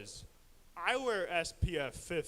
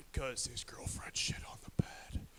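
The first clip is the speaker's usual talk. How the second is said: unvoiced whisper of the punchline; 6 semitones lower, 4 dB quieter than usual. Real, not acted. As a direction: hush it like a secret.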